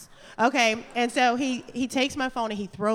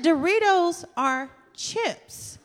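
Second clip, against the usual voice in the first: laughing insistence; 9 semitones higher, much slower than usual. Retold or imitated. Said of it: "And I said".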